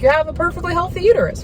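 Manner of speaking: lightly mocking bright doctor. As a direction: play the cheery doctor, quick and light. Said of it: "she's like".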